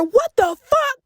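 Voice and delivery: high-pitched imitation of an angry aunt; outraged yell. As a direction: exclaim as if from another room. Aunt yelling from upstairs.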